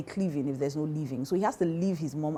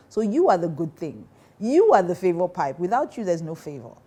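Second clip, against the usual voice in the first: "you" hammered, building louder each line; preaching louder; 7 dB louder than usual. Real, not acted. Preaching climax, rising force.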